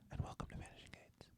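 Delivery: soft breathy ASMR whisper. Pretend. Cohost introducing himself in an ASMR whisper. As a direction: whisper right into the mic. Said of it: "when you whisper".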